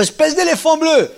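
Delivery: put-on boy yelling a silly insult; shouted insult. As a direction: bellow it in the playground. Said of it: "il a gueulé dans la cour".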